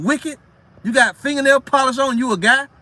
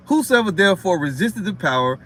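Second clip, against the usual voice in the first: low, measured Bible reading; scripture reading; 4 semitones lower than usual. Acted. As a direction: read the verse with solemn weight. Reads scripture aloud.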